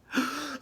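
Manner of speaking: high gasp. Acted. Mother sees daughter carried off.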